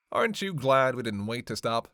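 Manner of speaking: normal talking voice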